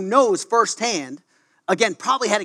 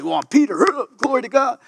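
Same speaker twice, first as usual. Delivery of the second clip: cocky; 5 semitones higher than usual. Retold or imitated. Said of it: "He didn't say".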